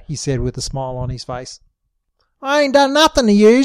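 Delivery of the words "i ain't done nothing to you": high, raised yell. A narrator's reading. Sheila yelling at her captors, high and raised. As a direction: shout it back at them. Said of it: "she yelled".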